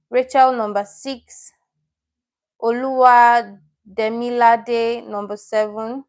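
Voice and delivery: ordinary talk, nothing marked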